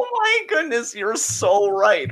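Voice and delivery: high-pitched burst of sudden realisation; astonished. Real, not acted. Host realising February is short, astonished. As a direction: shoot up high, then stretch out the agreement.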